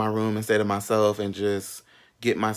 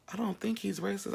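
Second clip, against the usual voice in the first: high, hushed inner voice; 10 semitones higher, 8 dB quieter than usual. Retold or imitated. Quoting own doubts, pitched up.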